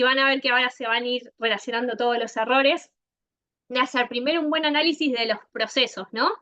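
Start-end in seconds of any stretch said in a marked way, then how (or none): none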